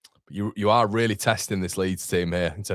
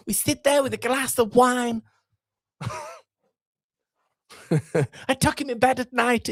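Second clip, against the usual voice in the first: much higher put-on manager voice, doting; Daniel Farke impression. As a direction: gush over your player in a high mock voice. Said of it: "in the Daniel Farke impression".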